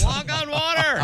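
mock heckler. Cohost joining in as the heckler, mock taunting call. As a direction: taunt him like a heckler, lightly hammed-up.

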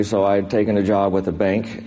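usual speaking voice

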